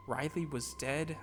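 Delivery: usual speaking voice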